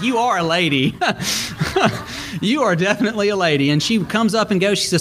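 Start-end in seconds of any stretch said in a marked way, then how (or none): 0.0-3.7 laughing. Retold or imitated. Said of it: "I'm like".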